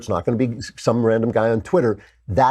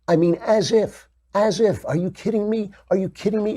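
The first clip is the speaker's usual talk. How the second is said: much higher, disbelieving are you kidding me; a pundit scoffs at the media, incredulous and much higher; 10 semitones higher than usual. Real, not acted. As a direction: pitch shoots up in disbelief. Incredulous rant.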